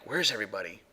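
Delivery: hushed imitation of the EVP voice; soft ghostly imitation. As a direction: mimic a faint voice from the recording. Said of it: "right after you hear someone like".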